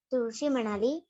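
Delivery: usual speaking voice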